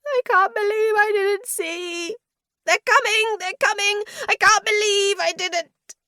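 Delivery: high, loud, weeping hysterics; weeping panic. A narrator's reading. Vardy shrieking and weeping on the ground. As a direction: sob it out in terror. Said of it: "He was weeping".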